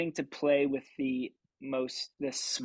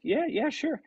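eager yeah; 13 semitones higher, 3 dB louder than usual. Retold or imitated. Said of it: "and I was like".

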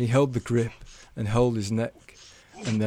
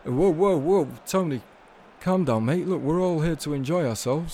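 higher, soothing but firm; Jeffrey tries to calm an angry Tony; calming friend; 6 semitones higher, 3 dB louder than usual. A narrator's reading. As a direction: settle him down, mate.

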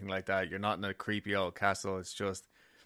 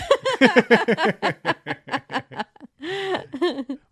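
loud laugh; 10 dB louder than usual. Real, not acted. Big laugh.

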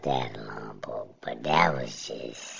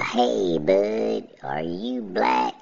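a hint of the old lady, higher and slow, mock suspicious; lightly mock her asking slowly, pitched up; imitating the old lady; 7 semitones higher, slower than usual. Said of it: "She be like".

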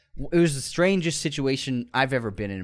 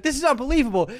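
high-pitched imitation of an outraged date; retold indignant outburst; outraged disbelief; 12 semitones higher, faster than usual. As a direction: huff it in mock indignation, pitched way up. Acted quarrel, outraged date.

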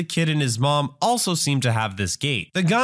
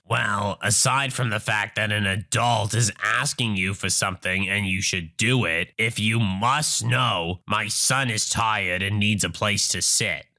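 peeved entitled mother; 6 semitones lower than usual. Acted. Entitled mother explains why her son needs the seat, peeved and condescending. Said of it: "Entitled mother getting peeved off".

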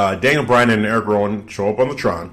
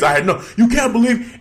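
much higher, desperate and half laughing; mock pleading Daniel Bryan; 12 semitones higher than usual. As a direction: plead innocence over the top. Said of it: "He's telling Roman Reigns".

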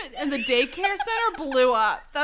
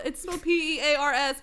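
plain reading voice

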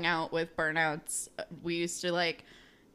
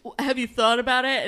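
louder, prodding bro question; teasing bro; 3 semitones higher, 6 dB louder, faster than usual. Retold or imitated. Retelling a show, teen boy prods his friend in a louder bro voice. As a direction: poke at him like a pushy buddy. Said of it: "then Moose goes".

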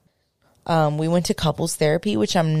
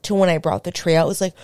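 ordinary delivery

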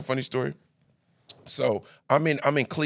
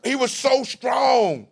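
excited; 8 semitones higher, much slower than usual. Real, not acted. Telling excitedly.

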